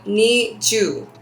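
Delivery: ni ju spoken slowly, syllable by syllable; slow Japanese. Shown as a demonstration. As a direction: sound out ni ju carefully. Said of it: "for twenty, you say".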